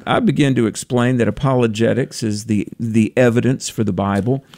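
ordinary talk

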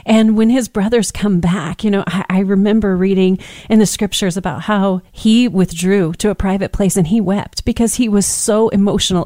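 usual speaking voice

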